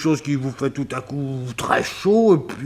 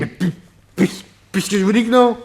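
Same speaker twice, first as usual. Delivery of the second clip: higher, louder flustered protest; flustered insisting; 9 semitones higher, 7 dB louder, faster than usual. Acted. Rattled witness insists to the policeman.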